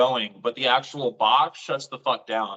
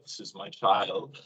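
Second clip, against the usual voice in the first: tranced tenderness; 5 semitones lower, 5 dB quieter, slower than usual. Retold or imitated. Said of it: "I'm like".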